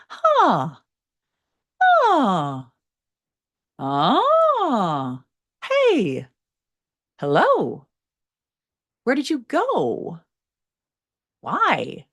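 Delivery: demonstrating high pitch, one word at a time; high register practice. Shown as a demonstration. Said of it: "practice going into those higher pitches by repeating after me".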